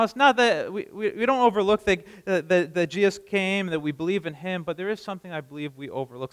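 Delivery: usual speaking voice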